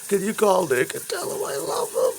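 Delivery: high, whiny imitation of a crying girl; mock her tearful plea; crying girlfriend.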